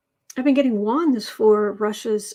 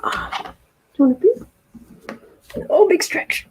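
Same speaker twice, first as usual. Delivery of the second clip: cooing to cat; 3 semitones higher, 7 dB louder, slower than usual. Real, not acted. Owner cooing as her cat stretches. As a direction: coo over the stretch.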